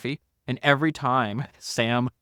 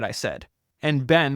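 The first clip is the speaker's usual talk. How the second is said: normal talking voice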